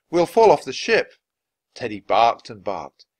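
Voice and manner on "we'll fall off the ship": high, loud alarm; shouted warning. A narrator's reading. Jack warns Annie on the tilting deck, shouting. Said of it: "shouted Jack".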